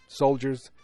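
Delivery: ordinary talk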